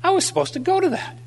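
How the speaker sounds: high, quick punchline; sudden realisation. Acted. Holy Spirit hitting himself on the head, mock dismay in a high voice. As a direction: blurt it high, as if just remembering.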